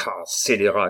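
mildly outraged exclamation; a touch of indignant shout; cried insult. A narrator's reading. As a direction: hurl it at a villain. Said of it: "cria Bussy".

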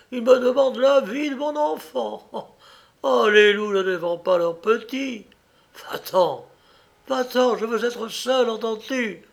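high-pitched, impassioned outburst; anguished indignation. A narrator's reading. Mother defends her child from husband, anguished indignation. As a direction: drive him away in a high, outraged voice.

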